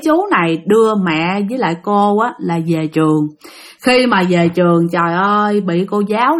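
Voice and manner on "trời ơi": plain reading voice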